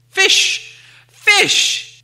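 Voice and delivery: very high, loud excited shriek; excited cry. Acted. Gollum crying out the answer to Bilbo, high and loud. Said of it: "he cried".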